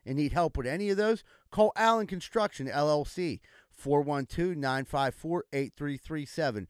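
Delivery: upbeat local radio ad, listing the services; sponsor ad read. Acted. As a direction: sell it like a hometown commercial spot. Reads a radio-style sponsor ad.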